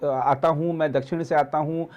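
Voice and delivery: ordinary talk, nothing marked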